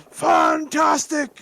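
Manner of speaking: high, slow, loud dwarf shout of "Fantastic!"; dwarf character shout. Retold or imitated. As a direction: bellow it like a jolly game dwarf. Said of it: "it just goes".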